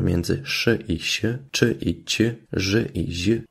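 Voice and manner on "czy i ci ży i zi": normal talking voice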